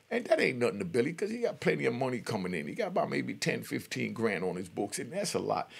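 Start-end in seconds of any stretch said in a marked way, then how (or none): none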